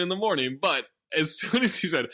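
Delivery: ordinary talk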